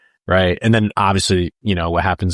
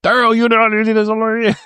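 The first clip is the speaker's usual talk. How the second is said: high, loud, garbled actor imitation; mock Southern maniac slurring the words together; gibberish impression; 12 semitones higher, 4 dB louder than usual. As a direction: blurt the line as unintelligible high-pitched gibberish. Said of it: "just saying the same gibberish".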